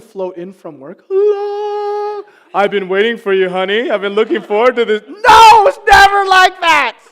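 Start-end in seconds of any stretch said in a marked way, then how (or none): 1.1-5.0 high, loud sugary greeting; mock sweet wife. 5.1-7.0 loud, high laughing denial; laughing shout. Real, not acted.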